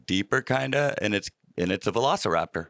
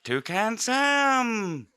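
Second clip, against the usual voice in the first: cartoon mascot voice; 10 semitones higher, much slower than usual. Toucan Sam sung name.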